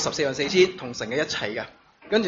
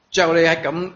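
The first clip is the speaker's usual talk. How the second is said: ordinary delivery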